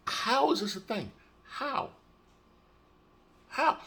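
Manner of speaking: high, soft, exasperated how; pleading with the universe in disbelief; baffled disbelief. Retold or imitated. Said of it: "And I'm like".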